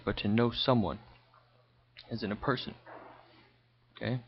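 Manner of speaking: ordinary talk, nothing marked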